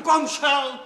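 loud, high, exasperated question; indignant shout. Acted. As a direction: throw the question out at full voice. Manželka vyjede po hádce. (rozhořčený výkřik)

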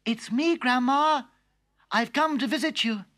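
put-on high sweet child; chirpy girl at the door; little girl voice. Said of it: "knocked at the door".

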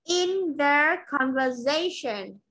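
slow, careful In their conversation; teacher models the phrase for the student to repeat; model pronunciation. Shown as a demonstration. Teacher models slowly and clearly.

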